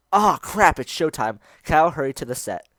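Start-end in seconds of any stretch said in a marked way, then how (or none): 0.1-0.8 sudden loud panic; startled exclamation. Real, not acted. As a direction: blurt it out, alarmed. Suddenly late for show.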